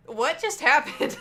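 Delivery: loud, slow, baffled question; stunned. Retold or imitated. Waitress baffled after the old couple leave, stunned. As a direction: ask it in total disbelief.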